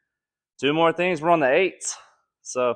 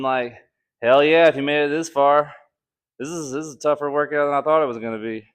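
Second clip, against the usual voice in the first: usual speaking voice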